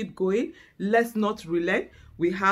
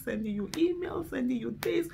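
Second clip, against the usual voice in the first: ordinary talk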